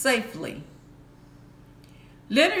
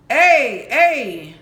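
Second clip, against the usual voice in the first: loud pirate reply; 7 dB louder than usual. Acted. Pirate obeys captain's order.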